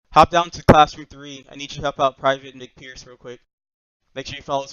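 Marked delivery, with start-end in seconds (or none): 0.1-3.1 loud slow call across room. Real, not acted. Sergeant calling across the room.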